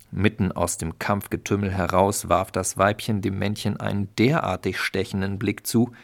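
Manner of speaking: ordinary delivery, nothing marked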